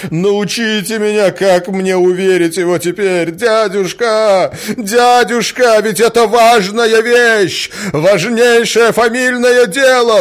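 loud, high, drawn-out exasperated cries; frantic pleading nephew. A narrator's reading. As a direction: plead at full voice, desperate to convince. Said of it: "брякнул Мозгляков в затмении ума". (отчаянно, громко умоляя)

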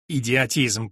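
usual speaking voice

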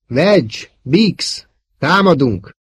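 loud, high battle call. A narrator's reading. Luke orders his wingmen to attack, loud call.

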